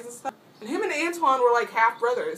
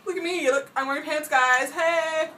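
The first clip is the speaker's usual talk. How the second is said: excited showing off; 8 semitones higher, 6 dB louder than usual. Pretend. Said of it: "I would just be like".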